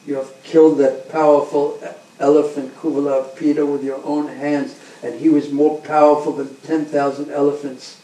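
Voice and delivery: loud, pushing challenge; booming wrestler. Acted. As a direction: bellow the challenge at the boys. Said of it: "And Chanora said".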